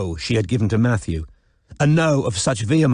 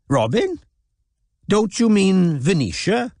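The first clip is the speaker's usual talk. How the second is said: Welsh voice; 10 semitones higher than usual. A narrator's reading. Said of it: "said a Welsh voice".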